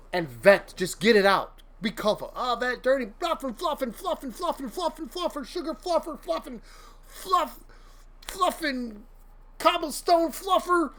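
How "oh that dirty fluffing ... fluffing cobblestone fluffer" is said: high, soft, sing-song fake cursing; a playful made-up cursing demo; mock venting rant. Shown as a demonstration. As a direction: rattle off silly stand-in swear words to blow off steam. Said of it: "just be, you, colorful".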